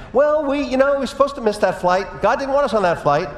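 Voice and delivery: husband imitating his wife's reassuring faith, high and loud; imitating Rose.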